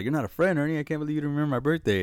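high-pitched, lightly hammed-up reproach; mock accusation. Retelling how Ernie got scolded for forgetting, mock accusation. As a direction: retell it as an indignant, quick scolding.

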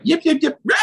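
high yipping shout; coyote yips. Retold or imitated. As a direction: yip like a coyote on a kill. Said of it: "it's like".

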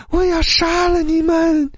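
very high, slow, drawn-out ghostly threat; an eerie female ghost threatening death. A narrator's reading. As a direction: wail the threat from beyond. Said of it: "女鬼的声音依然恐怖".

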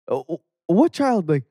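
put-on quoting the mom rushing on the phone in a heavy African accent; hardcore African accent. Mom on phone to school, heavy African accent. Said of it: "she does it hardcore".

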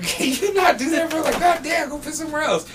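high-pitched, exasperated retold protest; exasperated plea. Worker telling bum to go elsewhere, exasperated. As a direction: plead with voice pitched well up.

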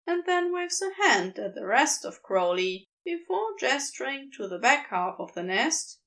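ordinary delivery, nothing marked

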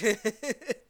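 short, high, loud laugh of scorn; loud scornful laugh; mocking laugh. Real, not acted. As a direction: burst out laughing at the absurdity. Laughs after quoting tweet.

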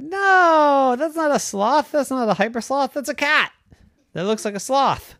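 high-pitched, insistent objection; high storybook outrage at being fobbed off; indignant protest. A narrator's reading. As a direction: start on a loud, long no. Girl rejects clerk's cat offer.

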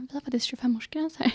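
whispered, fast bouncer line; fast whispered mock bouncer. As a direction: hush it out like a doorman. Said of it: "så bara".

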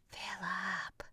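breathy gasping whisper. Acted. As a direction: whisper the name in disbelief. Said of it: "whispers Dmitri".

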